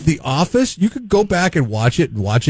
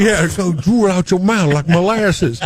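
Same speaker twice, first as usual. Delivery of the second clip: Southern drawl; 4 semitones higher than usual. Retold or imitated. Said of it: "Yeah, the drawl".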